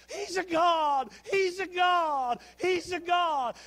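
high, drawn-out shouted chant; loud mock-worship cheer; crowd acclamation. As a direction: chant it slowly like an adoring crowd. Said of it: "who got up there and went".

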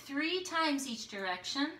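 normal talking voice

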